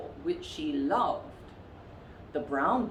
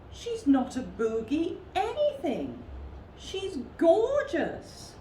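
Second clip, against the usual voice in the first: admiring bear voice; 12 semitones higher than usual. A narrator's reading. Floppy-eared bear admires her.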